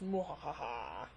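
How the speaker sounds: slow, low evil laugh; mad scientist cackle. Pretend. Teases next week's Brain of Morbius.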